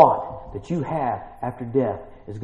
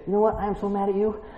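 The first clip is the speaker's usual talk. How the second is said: higher-pitched, lightly played put-out husband; a hint of a pouting, fed-up husband; preacher voicing the husband in a pretend fight, mildly mock-mad; mock angry husband; 9 semitones higher than usual. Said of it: "Tim can say".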